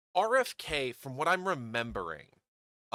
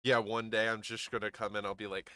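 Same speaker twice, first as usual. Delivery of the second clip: ordinary talk, nothing marked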